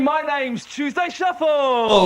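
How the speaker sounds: shouted slowly, name stretched out; slow shouted announcement. Acted. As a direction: announce it like a ringmaster.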